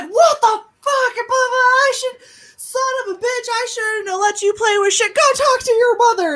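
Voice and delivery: very high, hammed-up furious rant; mock shrieking parental outburst; yelling dad. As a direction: imitate an exasperated dad losing it. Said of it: "You're yelling at me".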